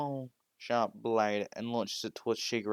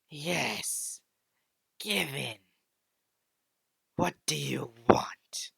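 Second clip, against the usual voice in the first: sinister whisper; 4 semitones higher, 4 dB louder, much slower than usual. Acted. Dark entity tempts Deku.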